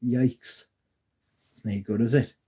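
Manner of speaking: usual speaking voice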